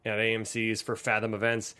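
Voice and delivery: normal talking voice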